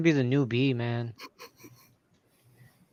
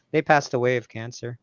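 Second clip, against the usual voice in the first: ordinary talk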